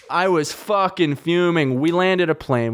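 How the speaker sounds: ordinary talk, nothing marked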